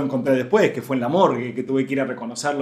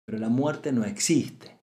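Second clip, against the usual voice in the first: slower, settling the words gently; slow reflective repeat; slower than usual. Shown as a demonstration. Teacher to listeners, repeating slowly so it sinks in. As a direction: let it sink in, slowly. Repeats the key phrase twice.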